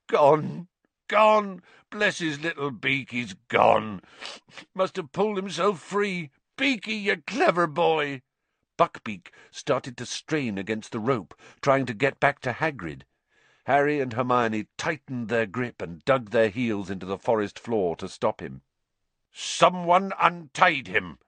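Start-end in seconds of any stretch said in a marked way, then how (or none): 0.1-8.2 high, broken tearful cry; sobbing with joy. Acted. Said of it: "Hagrid's words through his sobs". 19.3-21.2 snarling. Acted. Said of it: "the executioner was snarling".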